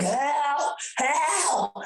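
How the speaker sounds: stuck in a puddle, hammed-up high wail for help; mock cry for help. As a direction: wail for help, hamming up the panic.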